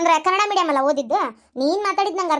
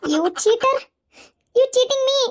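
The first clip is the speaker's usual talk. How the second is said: mock accusation; 7 semitones higher, 3 dB louder, much slower than usual.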